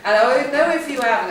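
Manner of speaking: real laughter breaking through the words; laughing, barely holding it together. Real, not acted. Host laughing at her own joke.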